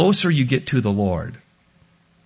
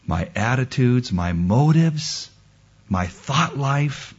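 usual speaking voice

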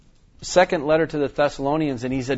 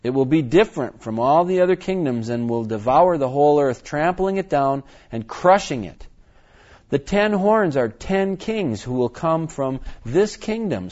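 scripture reading; 4 dB louder than usual. Acted. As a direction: proclaim the prophecy deliberately.

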